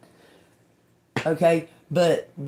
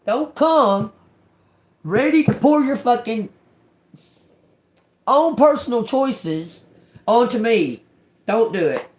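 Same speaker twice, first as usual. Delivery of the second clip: higher, louder, hammering each phrase; angry warning; 6 semitones higher, 5 dB louder than usual. Real, not acted. Warning whiners off her doorstep, angry and loud. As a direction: draw a hard line, fed up. Warning off people who dump problems.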